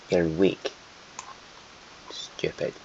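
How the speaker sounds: rough attempt at a Scottish accent. Muttering at the weak country in a put-on Scottish accent. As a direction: lapse into a not very convincing Scottish accent. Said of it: "I just keep doing a Scottish accent".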